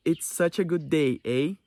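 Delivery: "It's such a good day, eh?" said slowly with a rising "eh" tag; a slow Canadian line ending on a questioning "eh". Shown as a demonstration. Teacher showing students how Canadians end sentences, slow with a rising eh. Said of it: "Por exemplo".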